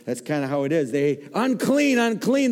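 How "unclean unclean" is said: high, loud cry of unclean; leper's loud warning cry; shouted warning. Acted. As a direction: call it out to keep people away. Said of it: "they shouted".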